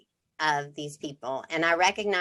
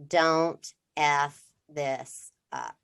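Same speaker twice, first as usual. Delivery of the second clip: slow, heavy, stern inner voice; slow inner warning; slower than usual. Retold or imitated. Mother voicing the slow stern voice in her head. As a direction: drag each word out like a warning. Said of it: "there is a voice that says".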